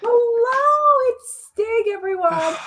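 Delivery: very high, loud singsong hello; excited introduction. Real, not acted. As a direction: present the guest with a high squeal of delight. Introducing arriving co-host.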